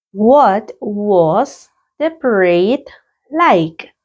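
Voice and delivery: very slow, stretched repetition; a drawn-out classroom model question; slow repeat. Shown as a demonstration. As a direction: say it slowly for learners to copy. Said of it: "Let's practice the pronunciation".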